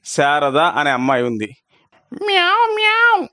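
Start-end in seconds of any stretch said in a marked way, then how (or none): 2.2-3.3 very high kitten meows; kitten meowing. A narrator's reading. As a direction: mew like a hungry kitten. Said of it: "అంది తల్లి లేని పిల్లి కూన". (చాలా ఎత్తైన స్వరంలో పిల్లికూనలా మ్యావ్)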